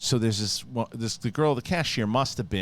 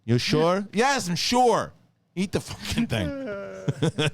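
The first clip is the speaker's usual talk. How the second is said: high outburst, slowing into the last order; mock exasperation; 10 semitones higher, much slower than usual. Store worker giving up on fish lady, hammed-up exasperation. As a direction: play a clerk at the end of patience. Improvised fish-return riff as staff.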